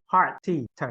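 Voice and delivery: "Heart" with the T released, then "T" by itself; final T. Shown as a demonstration. Teacher correcting student's missing ending, clear final T on "Heart". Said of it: "missing the T at the end".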